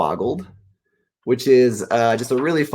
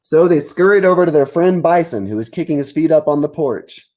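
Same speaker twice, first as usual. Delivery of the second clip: lively read-aloud narration, a touch higher; story narration; 7 semitones higher, 5 dB louder than usual. A narrator's reading. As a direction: read it out like a picture book to kids.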